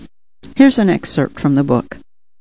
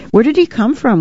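put-on higher-pitched child's question; curious boy; 7 semitones higher than usual. A narrator's reading. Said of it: "one boy asked".

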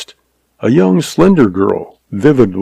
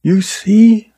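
high-pitched feminine character voice; schoolteacher's voice, pitched up; young woman; 11 semitones higher than usual. A narrator's reading. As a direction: speak up in a much higher girl's voice. Young woman answers her brother.

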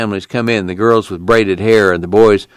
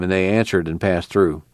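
ordinary delivery, nothing marked

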